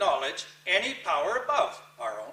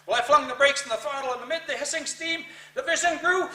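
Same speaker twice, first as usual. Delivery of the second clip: higher and louder, frantic; panicked shout; 6 semitones higher, 4 dB louder than usual. Acted. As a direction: yell it as you slam the brakes. Slamming the brakes in panic.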